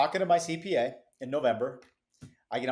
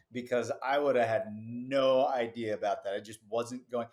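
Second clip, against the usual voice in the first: normal talking voice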